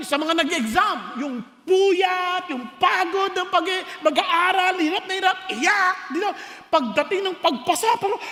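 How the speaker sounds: normal talking voice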